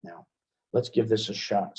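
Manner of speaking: normal talking voice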